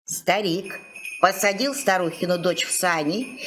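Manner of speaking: usual speaking voice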